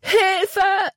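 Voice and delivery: high, loud cry for help; Libby screaming for help while falling. Acted. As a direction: scream it as she falls. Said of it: "und schrie laut".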